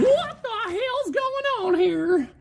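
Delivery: very high, slow stretched disbelief; incredulous. Real, not acted. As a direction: drag it out high in shock. Disbelief at big pull.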